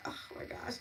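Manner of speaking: whispered oh my gosh, all air; breathless whisper. Real, not acted. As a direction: gasp it out under your breath. Host excited about next day.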